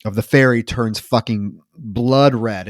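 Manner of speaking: normal talking voice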